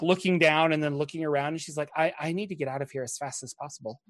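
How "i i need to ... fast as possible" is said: hushed, hurried, mildly spooked. Acted. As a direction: say it low and fast, eager to flee. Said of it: "super spooked of that circle".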